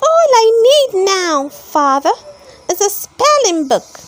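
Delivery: put-on high, eager boy puppet voice; a wooden boy asking his father for a book; bright puppet voice pitched well up; Pinocchio. A narrator's reading. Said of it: "said Pinocchio".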